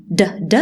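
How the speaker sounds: د د tapped off the tooth ridge; d sound. Shown as a demonstration. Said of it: "لساني لازم يكون في الالفيولار ريج".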